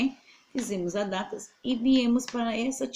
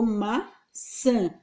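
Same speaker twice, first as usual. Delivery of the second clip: slow demo word. Shown as a demonstration.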